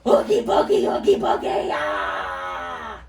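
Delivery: loud whispered oogie boogie, then a low growling ah; scary monster. Pretend. Teacher as the green monster trying to scare kids, loud whispered spook. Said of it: "Can I scare you".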